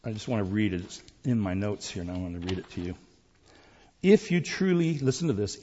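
ordinary talk, nothing marked

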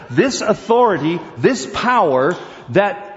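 preaching with mounting fervor; fervent preaching. Acted. Preacher building fervor over the beast's power. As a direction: drive the verse home to the pews.

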